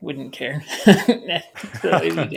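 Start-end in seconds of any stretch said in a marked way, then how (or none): 0.7-1.8 short laugh. Real, not acted. Laughs at own cow joke.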